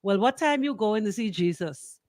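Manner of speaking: ordinary talk, nothing marked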